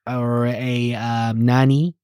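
put-on slow broken-English Filipino accent; mock Filipino accent. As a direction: play the eager Filipino nanny, slowly. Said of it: "I'm from the Philippines".

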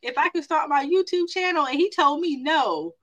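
imitating crying friend. Friend on the phone, mock tearful over YouTube channel. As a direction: play the crying friend told no. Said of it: "she was like".

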